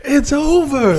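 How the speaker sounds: mock dramatic quote. Quoting the character's dramatic line to a friend, hammed up. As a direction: stretch it out like a cheesy movie line.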